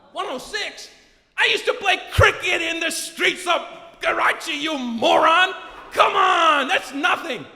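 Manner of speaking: loud, high, incredulous retort; mock-indignant shouting at the doctor; scoffing outburst. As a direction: blast back in comic disbelief.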